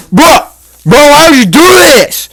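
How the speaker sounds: high pleading yell; hammed-up outraged bro; mock shout. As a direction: yell it at them in disbelief. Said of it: "I was like".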